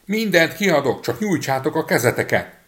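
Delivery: high, loud little voice calling out; tiny boy shouting loudly. A narrator's reading. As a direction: bellow so the maid hears. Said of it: "még hangosabban kiáltott".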